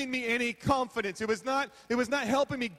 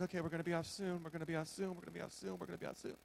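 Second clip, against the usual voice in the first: much softer, repeating to calm down; self-soothing repetition growing softer; whispered mantra; 5 semitones lower, 12 dB quieter, much faster than usual. Retold or imitated. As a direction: murmur it to yourself, fading away. Eyes closed, self-calming mantra.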